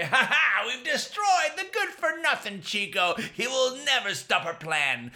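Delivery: high, loud mocking laugh and gloat; gloating bullies. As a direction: crow over the beaten hero. Bullies gloat over Chico.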